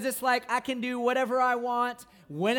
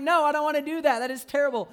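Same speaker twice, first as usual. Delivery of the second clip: mock whiny protest; 5 semitones higher, 6 dB louder, faster than usual. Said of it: "'Cause it's like".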